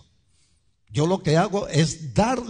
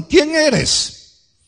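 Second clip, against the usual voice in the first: loud, high-pitched demanding question; pertanyaan para penanya; 13 semitones higher, 8 dB louder than usual. Acted. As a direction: call out the challenge as the questioners. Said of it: "Le preguntaron".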